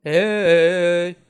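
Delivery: loud, drawn-out hail; calling out. Real, not acted. Player calls into the toilet, calling out. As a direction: holler to see who is there.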